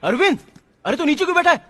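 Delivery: high, urgent fussing at a friend; agitated calling out. Acted. Friend finds his pal sitting on the floor, agitated. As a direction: call out high and insistent.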